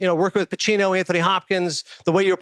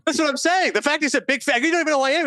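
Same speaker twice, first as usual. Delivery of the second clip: much higher, thrilled disbelief; can't believe his luck, voice leaping up; amazed excitement; 12 semitones higher than usual. Real, not acted. Telling his co-host about the star's reply, pitched high with amazement. Amazed that the star knew him.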